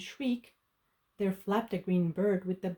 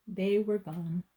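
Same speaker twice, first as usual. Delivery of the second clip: plain reading voice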